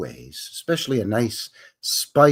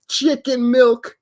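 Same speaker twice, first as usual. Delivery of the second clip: slow, giggly high read; giggling; 12 semitones higher, 4 dB louder, slower than usual. Retold or imitated. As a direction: giggle through the silly phrase. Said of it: "giggling to myself".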